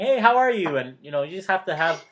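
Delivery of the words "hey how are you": higher bright hello to a stranger; greeting random people on the street, upbeat; friendly greeting. Retold or imitated. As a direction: say hi like you've known them forever. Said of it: "'cause I'm like".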